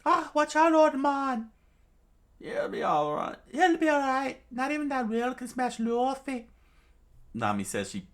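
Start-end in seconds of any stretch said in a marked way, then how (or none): 0.1-6.5 loud, very high squeaky falsetto; put-on cartoon voice far above your own; high-pitched character voices. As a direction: squeak it out urgently. Acted exchange between characters.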